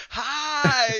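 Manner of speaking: high, loud, laughing "Hi!"; creepy doll hi. Retold or imitated. Doll surprising the voodoo master, high and loud. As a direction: pop out with a creepy laughing greeting. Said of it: "he turns around".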